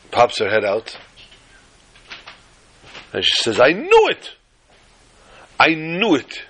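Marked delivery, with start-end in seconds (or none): 3.6-6.4 high, loud cry of I knew it; wife bursting out triumphantly; excited shout. Acted. Wife crowing to the Rebbe, loud and high.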